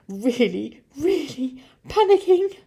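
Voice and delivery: building to a high, loud squeal; increasingly frantic, high narration; rising panic. A narrator's reading. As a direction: let panic climb word by word.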